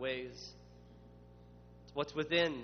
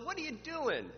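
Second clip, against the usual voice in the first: higher, incredulous what-are-you-doing; exasperated Pharisee; 11 semitones higher than usual. Acted. Pharisees challenging Jesus, incredulous and exasperated. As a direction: toss the question up in disbelief. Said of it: "When they say".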